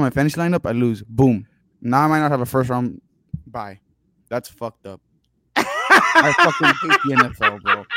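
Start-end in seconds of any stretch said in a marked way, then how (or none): 5.6-7.9 laughing rant. Real, not acted. Venting about fantasy football losses.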